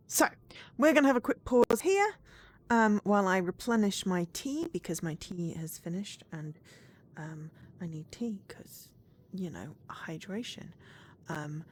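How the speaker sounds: ordinary talk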